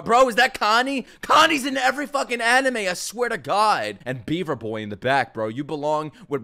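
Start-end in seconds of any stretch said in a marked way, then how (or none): 0.0-4.0 loud, high-pitched fan reaction; excited outburst. Real, not acted. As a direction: burst out loudly in excited disbelief.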